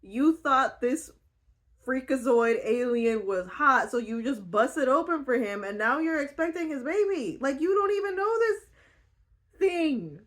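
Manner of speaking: high, loud, slow incredulous lecture to a friend; pleading with Georgie, high, loud and exasperated; exasperated scolding. Retold or imitated. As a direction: scold her like a friend who made a terrible choice. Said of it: "And I was like".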